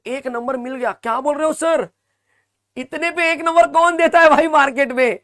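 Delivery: high-pitched, incredulous outburst; mock amazement. Teacher marvelling to students over a free mark, mock amazement.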